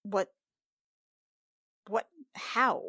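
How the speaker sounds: ordinary delivery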